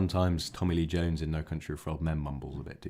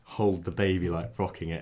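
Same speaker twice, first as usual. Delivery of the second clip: usual speaking voice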